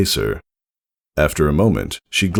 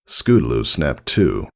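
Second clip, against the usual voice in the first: ordinary delivery, nothing marked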